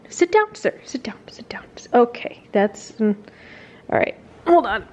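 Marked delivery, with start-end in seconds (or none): 0.1-1.6 hushed whisper telling the dog to sit; whispered command. Real, not acted. To her excited dog, a whispered sit command.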